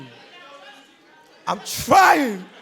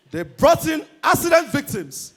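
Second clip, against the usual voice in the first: ordinary talk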